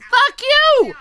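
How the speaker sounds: very high, loud angry yelling; furious shout. Real, not acted. Cursing out a critic, furious high shout. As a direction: yell it at full force, pitch way up.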